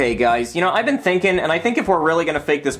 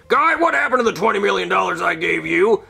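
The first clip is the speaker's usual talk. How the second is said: angry boss; 3 semitones higher than usual. Acted. NASA boss on the phone scolds Guy, lightly voiced and exasperated. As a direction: play the boss furious on the phone. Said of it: "it's my boss from nasa".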